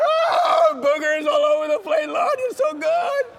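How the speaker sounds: hammed-up blubbering prayer to the Lord; crying like a baby. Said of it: "And I started crying like a baby".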